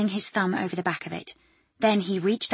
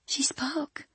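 stunned, unvoiced whisper; barely a whisper; 8 semitones higher, 5 dB quieter than usual. Ellen telling Dougie of the last words, awed whisper. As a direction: breathe it in awe. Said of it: "her own voice barely a whisper".

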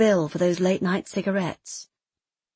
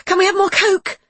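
put-on high, loud pleading kid's voice; eager child; 12 semitones higher, 8 dB louder than usual. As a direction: beg like an excited little boy.

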